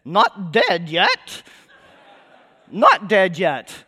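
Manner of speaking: slow, drawn-out mock cry from the corpse cart; body piping up. Preacher retelling a comedy song's plague victim, piping up slowly. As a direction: pipe up very slowly, stretching each word. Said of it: "this one body pipes up".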